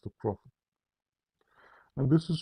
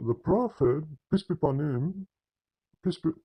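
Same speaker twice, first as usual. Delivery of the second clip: blessing rattled off, higher pitch; careless formula; 4 semitones higher than usual. Retold or imitated. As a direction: imitate a hasty, rote blessing. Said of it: "just pay attention how they say it".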